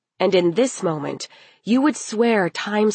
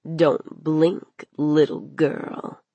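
lightly lowered villain voice, drawn out; menacing warning; 3 semitones lower, much slower than usual. Acted. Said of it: "he warned her again".

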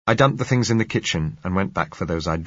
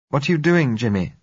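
raised, louder, a hint of worry; anxious young woman; 8 semitones higher, 4 dB louder than usual. A narrator's reading. Blind girl asking her partner, lightly anxious. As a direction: call out, unsure what he is up to.

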